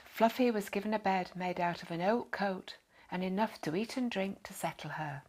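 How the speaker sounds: ordinary delivery, nothing marked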